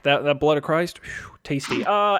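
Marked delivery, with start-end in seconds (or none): 1.0-1.8 soft breathy aside; whispered. Acted. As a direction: whisper it like a secret treat. Joke about communion wine.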